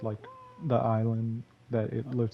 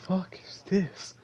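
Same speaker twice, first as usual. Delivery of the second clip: puzzled; 7 semitones higher, 4 dB quieter than usual. Retold or imitated. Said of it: "to be like".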